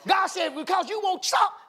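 high, forceful preaching of God's warning; fired-up preaching. Real, not acted. Preacher voicing God's warning to the church, fired-up preaching. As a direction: proclaim it urgently, pitched well up.

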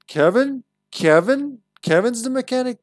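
loud, high, incredulous questions; surprised. Real, not acted. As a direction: react in disbelief at the name. Surprised by a name in memo.

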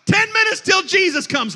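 high, loud mock panic; a hammed-up sinner watching the clock; mock alarm. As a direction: raise the pitch in pretend alarm. Acting sinner checking his watch.

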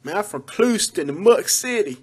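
street-talking local bragging about home; punchy local slang voice; Clewiston local. Acted. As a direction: put on a proud homie from Clewiston. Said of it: "But the locals say Clewiston".

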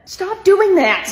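exasperated. Acted. Annoyed sibling yells at dog bit.

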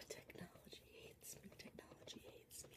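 very soft, voiceless whisper; defeated whisper. Real, not acted. Vlogger fed up with a late upload, defeated whisper. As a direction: whisper it to yourself in despair. Mad at slow video processing.

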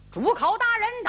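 high, fast herald's cry; shouted announcement. Acted. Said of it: "有人高喊". (高声通报)

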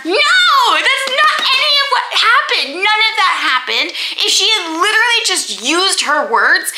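indignant fan rant, pitch way up; heated rant. Real, not acted. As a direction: push back, voice climbing in disbelief. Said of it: "ranting about Daisy".

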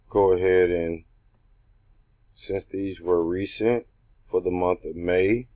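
ordinary delivery

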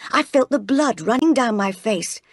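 ordinary delivery, nothing marked

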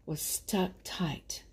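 slow, breathless whisper; suspenseful storyteller whisper. Acted. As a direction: hush it for suspense. Dramatic storybook beat.

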